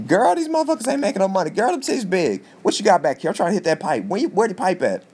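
camp it up high and quick, demanding the pipe; sassy cross-dresser voice. Retold or imitated. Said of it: "He's mad he's not making any money".

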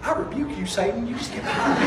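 hushed comic rebuke; mock rebuke. Preacher balking at paying for the cart, hushed mock rebuke. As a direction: say it under your breath, as a joke.

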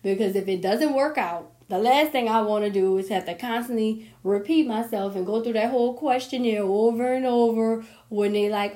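usual speaking voice

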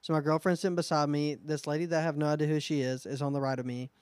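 usual speaking voice

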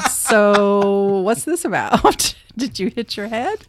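long drawn-out, leery question; slow, teasing mock suspicion; sceptical questioning. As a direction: stretch each word out slowly, probing. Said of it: "I don't know if anybody ever said".

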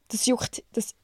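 words catching in the throat; choking on a drink. Real, not acted. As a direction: choke on it as you speak. (verschluckt beim Sprechen)

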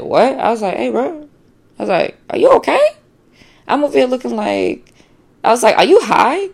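high, incredulous questions; startled disbelief. Retold or imitated. Woman questioning her suddenly jealous boyfriend, high and incredulous. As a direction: ask it in shock, pitch way up.

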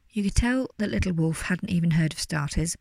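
ordinary delivery, nothing marked

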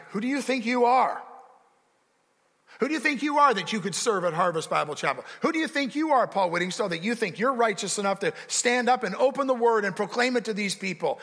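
the devil jeering at the preacher's nerve; Satan's accusing taunt. Retold or imitated. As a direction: sneer it down at him like the accuser. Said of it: "he says things to you like".

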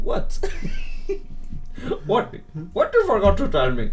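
imitating an Indian boy's English; Indian accent in English. Imitating the Indian kid to his friend, mock accent. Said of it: "Ele fala assim".

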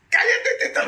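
high loud yell to shut him up; mock shout. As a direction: snap loudly at the panicking intern. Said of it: "me decía Tito".

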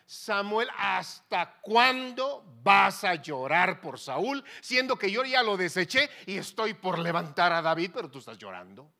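loud, commanding rebuke, stretched words; God rebuking Samuel. Acted. God to Samuel weeping over Saul, loud rebuke. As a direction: thunder it like an exasperated God. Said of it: "y Dios esperando".

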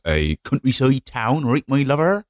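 put-on Gloucestershire farmer accent; broad English countryside accent; West Country farmer. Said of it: "That's what they all sound like. They're all farmers".

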